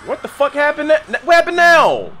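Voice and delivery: high, loud shocked outburst. Real, not acted. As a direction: react to a sudden blast. Explosion in game.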